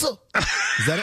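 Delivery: ordinary talk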